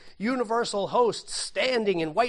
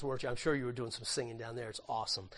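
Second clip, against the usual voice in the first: ordinary delivery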